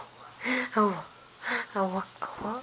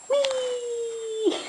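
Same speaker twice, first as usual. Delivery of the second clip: put-on a delighted high "whee" for the baby; high playful whee; 10 semitones higher than usual. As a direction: squeal it way up high.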